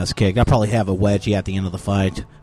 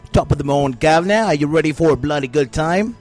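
hammed-up English accent, pitched higher; 4 semitones higher than usual. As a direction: play a mock English gent with a cheery English accent. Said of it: "would say in a very English accent".

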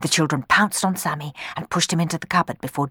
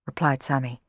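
usual speaking voice

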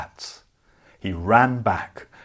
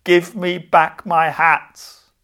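slow, louder, higher cross shout up into the trees; 5 semitones higher, 7 dB louder, slower than usual. A narrator's reading. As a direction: bellow it slowly up at the thieving monkeys. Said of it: "The man was cross ... shouted up at them".

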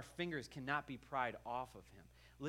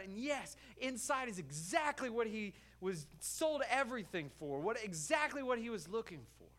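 high, loud, building preacher's climax; preaching at full force; 10 semitones higher, 6 dB louder than usual. Acted. Preacher tells the church the man found his treasure, full force. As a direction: drive it home louder and higher each line.

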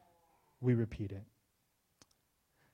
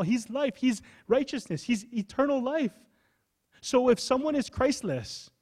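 higher, insistent preaching voice; full-force preaching; 11 semitones higher, 3 dB louder than usual. Acted. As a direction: preach it up, pressing the point. Preaching builds in intensity.

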